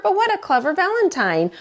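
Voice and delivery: high, quick, delighted; delighted rooster. Acted. Rooster to Turkey, high delighted praise. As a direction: gush over the clever card.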